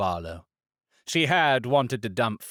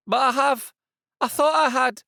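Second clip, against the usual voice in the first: much higher, pleading protest; flustered woman; 13 semitones higher, 4 dB louder than usual. A narrator's reading. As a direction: stammer out an excuse.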